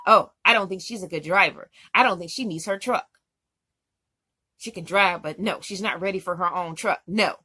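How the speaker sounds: loud, dismissive report on a speakerphone; loud speakerphone talk. Retold or imitated. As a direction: talk her down loudly so everyone hears. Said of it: "on the loud speakerphone telling her".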